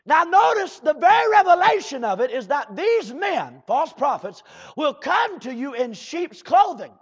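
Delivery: loud, high, driving preacher's cry; fiery preaching. Acted. Preacher rousing his congregation, loud fiery preaching. Preacher reading scripture at full force.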